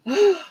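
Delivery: sharp high gasp of delight. Real, not acted. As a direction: gasp in real surprise. Sees another pressed design.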